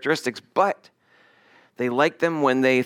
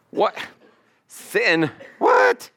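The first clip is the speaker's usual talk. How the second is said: jumps way up high on the last what; mock startled protest, last word shrieked high; shocked disbelief; 7 semitones higher, much slower than usual. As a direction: play the outraged sinner who can't believe it. Mock reaction to hearing of sin.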